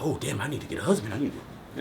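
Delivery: soft, quick imitation of a woman in a hurry to marry; worried woman, mock. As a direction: say it hushed and hurried, half mocking.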